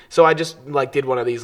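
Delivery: ordinary talk, nothing marked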